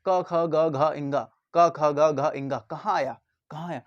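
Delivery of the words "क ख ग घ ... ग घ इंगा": क ख ग घ इंगा said low from the throat; throaty, low recital of क ख ग घ इंगा; throat consonants demo. Shown as a demonstration. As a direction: drop the voice for क ख ग घ इंगा. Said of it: "उसके बाद का वर्ग पर आ गए".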